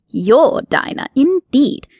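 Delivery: haughty rabbit, sniffing at her claim; offended rabbit, lifting his nose; indignant. Acted. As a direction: scoff at her as beneath him. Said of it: "replied with indignity".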